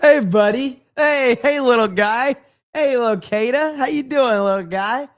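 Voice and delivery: put-on high, loud, talking down like to a small child; patronizing tall guy. Streamer mimics tall men greeting a short guy, high and loud. Mocking tall guys in elevator.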